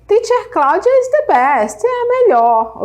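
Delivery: high, loud playful brag; playful boast. Real, not acted. Teacher boasting to her students, high and loud. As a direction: crow it to the class. Teacher jokes about herself.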